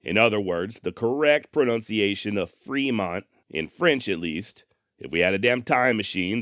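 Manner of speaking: ordinary delivery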